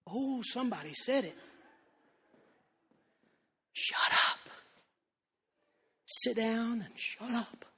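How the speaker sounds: put-on mother hissing at a child in church, hushed; hushed mock mother. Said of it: "She'd say".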